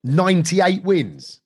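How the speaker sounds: real amazement. Real, not acted. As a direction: repeat it slowly in disbelief. Host amazed at the win record.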